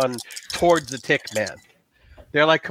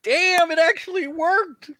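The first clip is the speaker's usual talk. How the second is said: high, drawn-out real astonishment; amazed; 13 semitones higher, slower than usual. Real, not acted. Game master reacts to player's lucky roll, amazed. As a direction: exclaim in disbelief, pitch way up.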